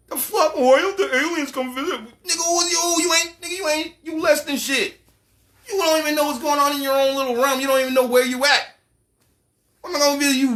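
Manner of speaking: high, incredulous alien voice; scornful alien. Acted. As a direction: sneer from high above like a bored overlord. Alien mocks humans' visit requests.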